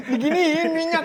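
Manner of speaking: high, loud startled laugh. Real, not acted. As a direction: laugh as the oil spits. Oil spits while frying.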